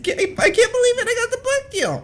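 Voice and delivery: high, laughing squeal of joy; giddy with joy, laughing high. Acted.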